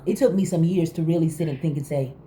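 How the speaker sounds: usual speaking voice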